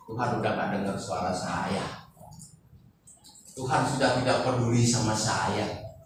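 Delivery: low, subdued lament; mistrustful, dejected prayer. Acted. Preacher acts out a mistrustful believer praying, low and dejected. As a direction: pray softly and low, as if given up on being heard. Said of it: "Penuh dengan prasangka".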